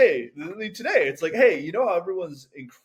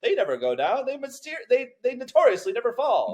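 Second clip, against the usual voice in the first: put-on high, loud, proud old-timey boast; boastful shipbuilder; 10 semitones higher, 4 dB louder than usual. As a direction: brag like a shipowner. Mock Titanic builder boasting.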